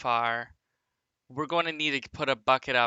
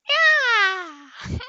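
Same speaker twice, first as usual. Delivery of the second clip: cat-like yeah; 11 dB louder than usual. Pretend. Meow-style yeah after the meows.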